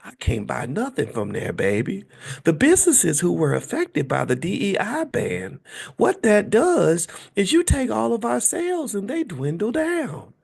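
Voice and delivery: lightly voiced gentle woman; Tabitha Brown voice. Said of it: "you go".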